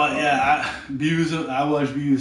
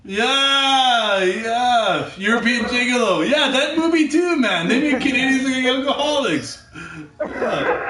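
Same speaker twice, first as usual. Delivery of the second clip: high, loud, eager agreement breaking into laughter; excited recognition; 8 semitones higher, 5 dB louder than usual. Real, not acted. Agreeing with friend about the Deuce Bigalow movie, excited recognition. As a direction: jump on it, pitched up and loud.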